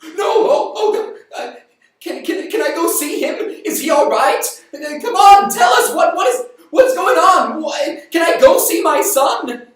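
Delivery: rising from high cry to full panicked shouting; mother pleading with the messenger, building to a shout; frantic shout. Acted. Mother demands news of son.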